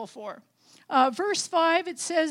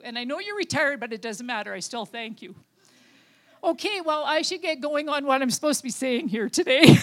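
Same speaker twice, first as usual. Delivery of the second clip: ordinary talk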